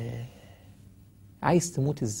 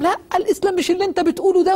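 heated debate shout; 6 dB louder, faster than usual. Retold or imitated. Sheikh arguing in a debate.

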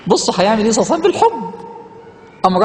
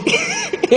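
short laugh; 3 dB quieter than usual. Real, not acted. Laughing at story.

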